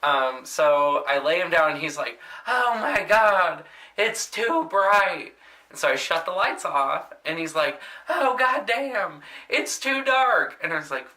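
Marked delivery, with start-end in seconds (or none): 2.5-5.3 high, slow whiny complaining voice; grumpy old man. Retold or imitated. Grumpy resident complaining about light. 8.1-10.6 high, slow whiny complaining voice; grumpy old man. Retold or imitated. Grumpy resident complaining again.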